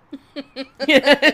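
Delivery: real laugh bursting out loud; loud burst of laughter. Real, not acted. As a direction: laugh out loud at own cheek. Laughs at her own joke.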